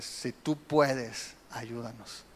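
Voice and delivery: slow, low, soft hesitant request; doubting plea. Retold or imitated. Father unsure of Jesus' ability, soft doubting plea. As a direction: plead quietly, unsure he is able. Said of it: "está dudando de qué, de su capacidad".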